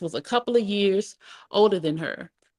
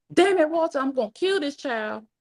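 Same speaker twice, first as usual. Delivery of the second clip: mad; 9 semitones higher than usual. A narrator's reading. Grandmother furious at her daughter, complaining to Walter, mad. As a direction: blurt an angry threat up high. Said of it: "Mad and out of breath".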